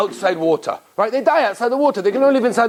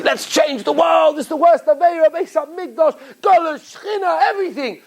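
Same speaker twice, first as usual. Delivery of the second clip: mock zealous shout; 8 semitones higher than usual. Preacher mocking the grand resolve to fix the world, mock shouting. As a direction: ham up the big crusade. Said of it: "come along and say".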